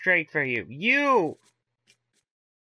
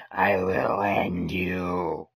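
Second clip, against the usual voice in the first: menacing whisper; 11 semitones lower, much slower than usual. Pretend. Player voicing threat to gods.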